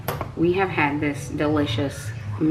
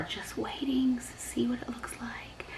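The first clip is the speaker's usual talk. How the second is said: whispering; 7 semitones higher, 11 dB quieter than usual. Real, not acted. Whispering while waiting.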